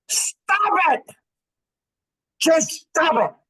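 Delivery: high, loud mock yell; shouted stop it. Retelling a man shouting at a woman, loud and high. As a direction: bark a sharp command to stop.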